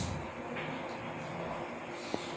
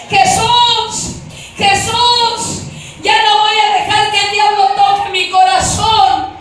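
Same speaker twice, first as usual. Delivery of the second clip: high, loud, drawn-out cry; screaming in despair; 7 semitones higher, 11 dB louder, slower than usual. Retold or imitated. Condemned woman in hell calling on Jesus, screaming. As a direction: cry out as a tormented soul. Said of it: "gritaba esto".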